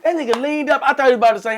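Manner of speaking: animated disbelief; excited retelling. Real, not acted. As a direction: tell it like you still can't believe it.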